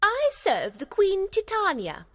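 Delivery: very high-pitched, grand fairy; proud. A narrator's reading. Fairy telling Puck whom she serves, proud. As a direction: announce it haughtily in a piping voice. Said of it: "the fairy replied proudly".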